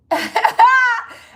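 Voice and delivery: short, high, loud laugh. Real, not acted. Admitting she can't use the name, loud high laugh. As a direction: let a high laugh burst out.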